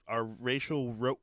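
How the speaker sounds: usual speaking voice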